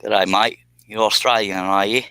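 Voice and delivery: playful fake Aussie accent; mock Australian accent. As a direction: try out a cheesy Australian accent on a viewer. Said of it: "if my Australian accent is good".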